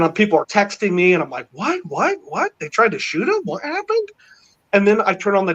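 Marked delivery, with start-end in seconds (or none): 1.5-4.1 much higher, rising what what what; shocked disbelief. Retold or imitated. Man retelling reading texts about the shooting, much higher shocked questions. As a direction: replay the jolt of hearing the news. Hearing news of assassination attempt.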